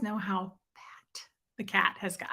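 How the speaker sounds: hushed secretive whisper. Real, not acted. Secret aside about the cat.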